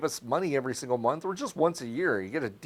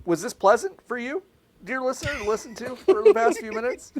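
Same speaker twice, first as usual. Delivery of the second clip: mock host voice; 9 semitones higher than usual. Mock addressing dear listener.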